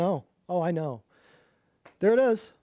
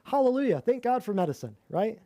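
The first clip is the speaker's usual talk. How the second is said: normal talking voice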